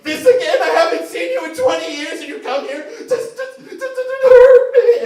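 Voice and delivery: high, loud, hammed-up outrage; wounded rat god. Rat god yelling at his fake mother, high and loud. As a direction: wail it like a betrayed son, cracking up. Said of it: "the rat god's just like".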